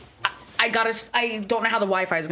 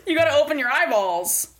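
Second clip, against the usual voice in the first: higher, emphatic repeat; teasing insistence; 5 semitones higher than usual. Real, not acted. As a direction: insist playfully, pitch jumping up. Teasing repeat after friend refuses.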